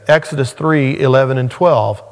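ordinary delivery

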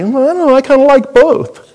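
put-on high, coy little kid; child's voice. Pastor voicing his child answering the parent, high and coy. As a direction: play the kid who wants both ways.

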